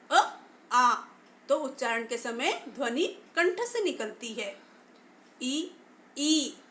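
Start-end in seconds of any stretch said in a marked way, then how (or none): 0.1-0.9 अ, आ voiced loud from the throat; vowel demo. Shown as a demonstration. Teacher asks students to try it, voicing loudly. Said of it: "आप भी बोलकर देखिएगा". 5.4-6.6 ई sounded against the palate; vowel demo. Shown as a demonstration. Teacher demonstrates palatal vowel for students. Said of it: "का उच्चारण करते समय".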